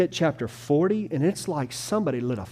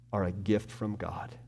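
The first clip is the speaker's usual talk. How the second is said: ordinary talk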